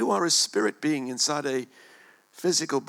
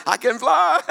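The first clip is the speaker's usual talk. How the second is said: much higher and louder, bursting with laughter; giddy laughing cry; 12 semitones higher, 9 dB louder than usual. Retold or imitated. Pilot up off the floor, shouting through laughter. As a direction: crow it out, laughing with joy. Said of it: "When he got up off the floor".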